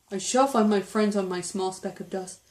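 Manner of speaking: plain reading voice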